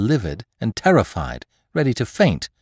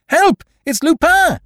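very high, loud alarm shout; 8 dB louder than usual. A narrator's reading. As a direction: yell for the servants. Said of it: "shouting to the servants".

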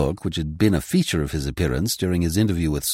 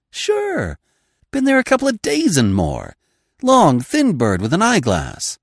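high, eager woman's voice; raised pitch young woman; Liz's voice; 11 semitones higher, 3 dB louder than usual. Acted.

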